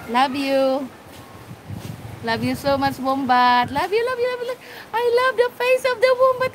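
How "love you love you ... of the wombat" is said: higher, fast doting sing-song; gushing excitement. Real, not acted. To the wombat, doting and higher with delight. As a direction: gush over the animal. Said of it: "I'm noisy".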